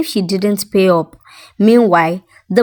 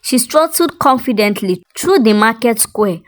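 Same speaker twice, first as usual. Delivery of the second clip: plain reading voice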